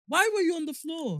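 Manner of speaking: high, startled question; surprised. Real, not acted. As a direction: ask it in sudden disbelief. Shocked reaction.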